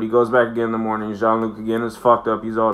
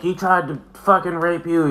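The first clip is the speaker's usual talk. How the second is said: hypothetical blunt line; 7 semitones higher than usual. Pretend. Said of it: "too fucking classy to be like".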